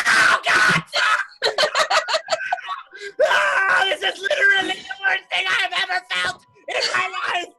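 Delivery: high, loud anguished screaming; burning scream. Acted. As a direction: scream in agony, pleading. Character screams while burning in lava.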